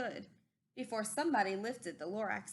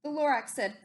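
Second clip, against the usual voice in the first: plain reading voice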